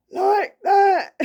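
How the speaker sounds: imitating a husky male ghost struggling to talk. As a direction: croak it out like an old man fighting for breath. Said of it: "this is my impression of the ghost".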